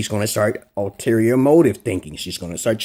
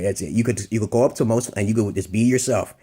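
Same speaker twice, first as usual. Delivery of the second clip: ordinary talk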